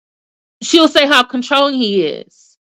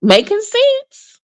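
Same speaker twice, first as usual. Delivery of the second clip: high, slow, knowing; high drawn-out ooh; mock impressed; 10 semitones higher, slower than usual. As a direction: savor it like a good point landed. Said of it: "I was like".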